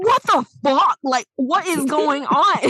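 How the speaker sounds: stunned what the fuck; shocked reaction. Retold or imitated. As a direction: react like you can't believe what you just saw. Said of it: "I'm like".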